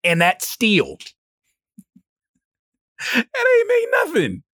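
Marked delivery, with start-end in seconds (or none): 3.1-4.4 much higher, crying out in frustration; exasperated cry. Real, not acted. About the download barely progressing, high exasperated wail. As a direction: wail it in disbelief.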